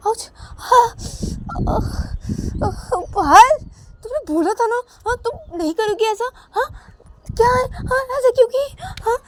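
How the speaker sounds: high, loud wounded whimpering reproach; crying in pain. Acted. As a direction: cry out and accuse her. Ananya hurt after the bite.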